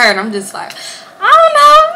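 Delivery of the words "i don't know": very high, loud mock innocence; hammed-up high-pitched denial; clueless squeal. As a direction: squeal it like you have no clue. Acts clueless reply to the boyfriend.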